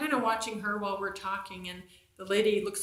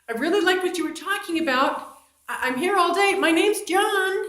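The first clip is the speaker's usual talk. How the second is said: eager desk clerk; 9 semitones higher, 8 dB louder than usual. Retold or imitated. Desk clerk calling after her over the counter, loud and eager. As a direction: call it out over the counter, overeager to keep her talking. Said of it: "John was leaning over the counter going".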